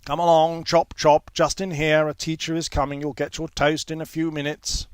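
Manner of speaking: loud brisk call to the group; brisk prefect calling. A narrator's reading. Prefect calling his wards, loud and brisk. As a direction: herd them along loudly, chop chop. Prefect herding new boys.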